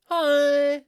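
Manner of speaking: high, loud put-on greeting; bright fake TV hello; fake presenter hi. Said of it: "trying to put on, like, this".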